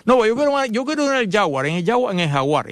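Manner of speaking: put-on high, insistent childish whine; whiny child. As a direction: repeat it like a stubborn kid. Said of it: "y te dice". (niño caprichoso, voz aguda insistente)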